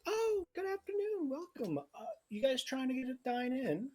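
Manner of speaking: cheery high voice greeting guests at the door; half-elf maiden. Acted. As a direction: lift way up into a chirpy maiden. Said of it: "she goes".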